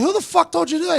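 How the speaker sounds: angry coach. Retold or imitated. Coach confronting player.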